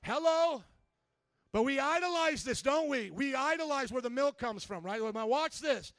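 punchy, forceful preacher delivery; full-force preaching. Real, not acted. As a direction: call them out from the pulpit.

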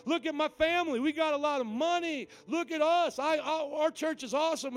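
very high, loud, drawn-out bragging; boastful Pharisee. Acted. Pharisaical people brag about wealth and church, loudly self-satisfied. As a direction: crow about yourself, stretching the big words. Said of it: "what do pharisaical people say?".

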